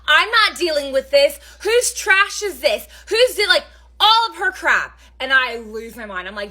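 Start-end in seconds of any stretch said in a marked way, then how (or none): 0.1-5.0 high, loud exasperated outburst; mock freak-out over the bugs; pitched-up, louder retold rant; losing it. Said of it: "I lost it".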